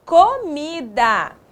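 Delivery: "comida" slow, syllables lengthened; drawn-out "comida"; sounding out. Shown as a demonstration. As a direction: repeat "comida" slowly for pupils. Teacher repeating formed word.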